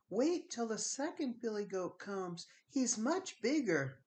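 high, soft pleading little goat; tiny goat voice. Acted. Tiny goat begs troll.

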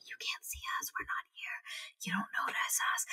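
an unvoiced, hushed whisper of a spell. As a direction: breathe it like a charm that hides you. Skadi hides them from people.

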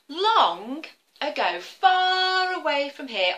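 high, slow once-upon-a-time voice; slow storytelling opener. A narrator's reading. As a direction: draw out the opening like a fairy tale. Story opening formula.

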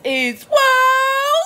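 high, loud sung-out catchphrase; high shouted show intro. Acted. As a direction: belt out the show's name. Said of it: "catchphrase shouted as show opener".

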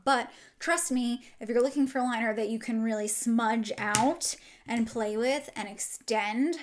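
usual speaking voice

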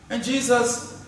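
ordinary talk

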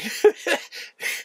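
brief laugh at own comparison; amused laugh. Real, not acted. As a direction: chuckle at your own joke.

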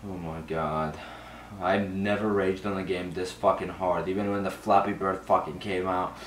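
very low, quiet grumble; exasperated and subdued. Real, not acted. Player confessing his rage after repeated losses, exasperated and subdued. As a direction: drop right down, worn out by frustration. Spent after raging at the game.

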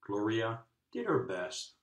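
ordinary delivery

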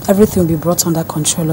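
usual speaking voice

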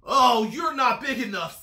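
loud dismissal, high drawn "Oh"; grouchy bug backing out with bluster; grouchy ladybug. A narrator's reading. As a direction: brush them off loudly. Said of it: "said the grouchy ladybug".

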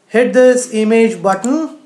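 ordinary delivery

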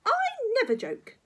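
lightly voiced severe old woman; stern grandma. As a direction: insist with loud dignity. Grandma denying she jokes.

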